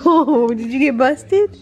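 amused teasing with a laugh, slowed; teasing the dog. Real, not acted. Owner teasing the caught dog, slow amused tease. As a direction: tease the pet that got caught.

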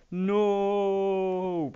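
high loud anguished cow no; cow crying no. Pretend. Voicing the cow mourning its friend, high wail. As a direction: voice the cow's grief with a high loud cry. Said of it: "that was the cow going".

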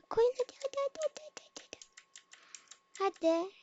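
very high, breathless whispered urging; frantic whisper. Acted. High whispered urging of teammates to place it. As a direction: hurry them on in a squeak.

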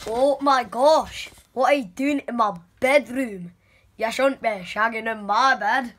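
lightly put-on Scottish accent; slow, indignant Scottish character. Catching intruders in the bedroom, indignant Scottish accent. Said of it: "I am not Scottish anymore".

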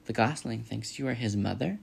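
ordinary delivery

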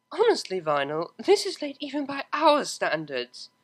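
a drowsy yawn through the complaint. Acted. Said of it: "yawned the angelic voice".

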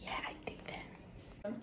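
hushed whisper. Real, not acted. Whispered aside at friend's house.

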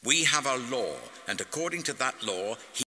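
high, loud, forceful insistence; insisting. A narrator's reading. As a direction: press the accusation hard.

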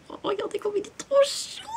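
high, soft cooing over something cute. Real, not acted. Attendrie devant un objet mignon. (voix aiguë attendrie)

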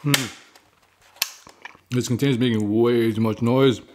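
chewing while talking; eating from a spoon; mouth full. Real, not acted. Seen 4: eating continuously from a spoon before, during and after the words.

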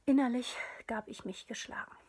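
plain reading voice

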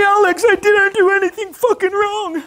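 loud falsetto howl of protest; mock wailing scream. Upset client to his coach, mock screamed wail. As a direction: imitate a man wailing, very high. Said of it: "he's just wailing and screaming".

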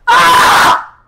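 short loud scream; sudden scream on request; test scream. Shown as a demonstration. Friend screaming to test the echo fix, test scream. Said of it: "Someone scream".

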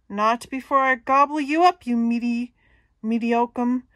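loud, booming troll threat. Acted. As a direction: boom up from below. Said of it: "Troll booms from below".

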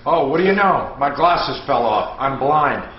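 ordinary talk

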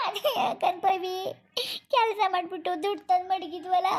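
slow, high wail through sobs; acted sobbing, words drawn out; crying. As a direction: break into tears while confiding in a friend. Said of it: "ಇಷ್ಟಕ್ಕೆ ಹೆಂಗೆ ಅಳ್ತಾ ಕೂತಿದ್ಯಾ".

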